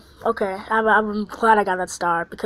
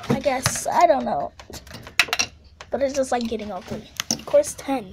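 usual speaking voice

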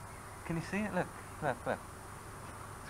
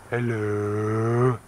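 slow hello; 3 semitones lower, 4 dB louder than usual. Shown as a demonstration. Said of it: "just do what I just did".

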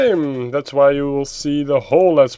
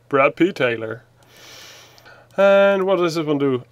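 normal talking voice

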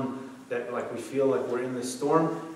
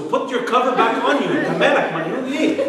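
higher, louder mock scolding; exasperated dad; 8 semitones higher, 7 dB louder than usual. Dad scolds son over a fallen cover, exasperated. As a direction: retell a fed-up parent's outburst.

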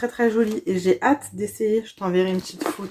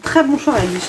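higher and louder, bright teasing approval; sing-song compliment to the friend; playful praise; 5 semitones higher, 5 dB louder than usual. Real, not acted. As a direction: cheer the friend's choice.

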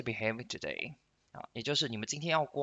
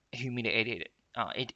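ordinary talk, nothing marked

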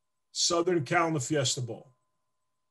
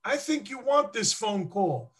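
much higher, coaxing woman's voice; imitating secretary; 9 semitones higher than usual. Player imitating the secretary coaxing him, high sweet voice. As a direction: coax him gently like a kind secretary. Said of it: "She goes".

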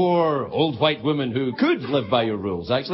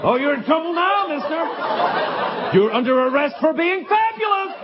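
high, camp, flirty put-on voice; hammed-up camp cop purring to the client; sexy stripper cop; 11 semitones higher than usual. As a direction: tease it like a campy stripper cop. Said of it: "sexy cop come to my house".

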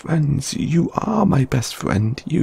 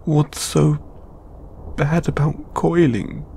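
ordinary talk

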